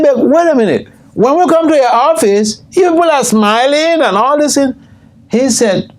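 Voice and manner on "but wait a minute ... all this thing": high-pitched mock puzzlement; acted protest. Speaker challenging a smiling airline official, high-pitched protest. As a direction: object in a raised, retold voice.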